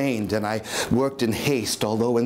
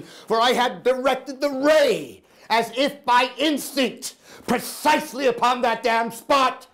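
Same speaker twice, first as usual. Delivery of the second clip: higher, tense, rising fury; intense madman narrator; 11 semitones higher than usual. Acted. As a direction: spit out each word with disgust. Madman narrator grows furious.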